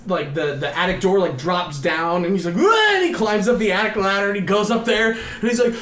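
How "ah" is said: very high, loud yelp; mock panicked scream. He flees up the attic ladder, high mock yelp. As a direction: yelp like someone scrambling away. Imitates boy fleeing to attic.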